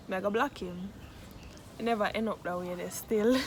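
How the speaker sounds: normal talking voice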